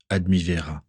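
usual speaking voice

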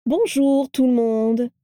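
high, slow, sing-out greeting; joyous exclamation. A narrator's reading. Vera bursting in to greet the men, joyously. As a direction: greet the whole room joyfully, drawing it out. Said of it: "s'exclama-t-elle joyeusement".

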